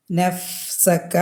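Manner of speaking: നഫ്സക with the f held long; lingering fa. Shown as a demonstration. Teacher showing a letter that takes time, held f. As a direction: spend time on the f in നഫ്സക, slowly. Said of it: "കുറച്ച് time spend ചെയ്യുന്നൊരു അക്ഷരമാണ്".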